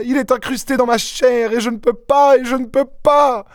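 very high, desperate woman's voice; frantic despair. A narrator's reading. Young wife to her rescuer, unable to remove the ring, despairing. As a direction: pour it out in wild, high-pitched despair. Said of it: "Elle eut un accès de désespoir fou".

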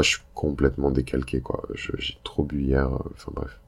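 rough, hungover morning voice. Real, not acted. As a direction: talk like you drank too much last night. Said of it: "vous pouvez l'entendre à ma voix".

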